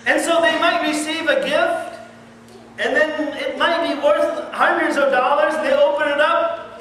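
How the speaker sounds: loud, high preaching at full force; fiery preaching. Acted. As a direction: hammer it home to the congregation.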